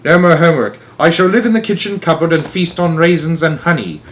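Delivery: put-on loud gleeful boy. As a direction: gloat about mouse life. Bruno gloats.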